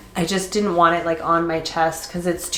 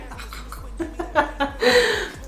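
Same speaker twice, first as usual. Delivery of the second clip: a high, airy giggle; breathy laugh; 12 semitones higher than usual. Real, not acted. Laughing after the confession.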